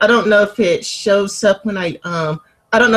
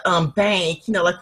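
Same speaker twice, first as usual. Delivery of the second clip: ordinary talk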